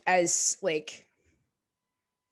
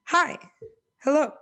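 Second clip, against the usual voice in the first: higher, louder mock partner calling him back; imitating partner; 9 semitones higher, 7 dB louder than usual. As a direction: playfully snap him out of a rant. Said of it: "she'd be like".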